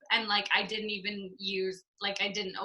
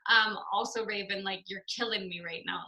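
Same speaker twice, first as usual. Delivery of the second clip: ordinary talk, nothing marked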